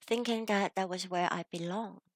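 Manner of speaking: usual speaking voice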